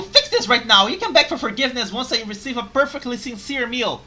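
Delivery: loud high scolding; angry demanding master. Acted. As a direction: demand it loudly. Customer plays demanding master.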